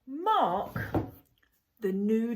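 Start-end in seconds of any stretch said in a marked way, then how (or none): none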